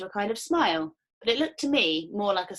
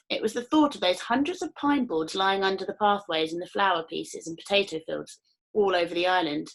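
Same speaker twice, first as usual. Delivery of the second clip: ordinary delivery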